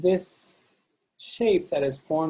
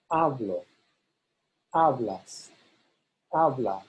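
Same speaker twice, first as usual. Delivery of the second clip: HA stressed in "hablo, hablas, habla", falling after; stress on first A; 2 semitones lower than usual. Shown as a demonstration. Teacher models boot forms, HA stressed in "hablo, hablas, habla". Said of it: "the A is stressed".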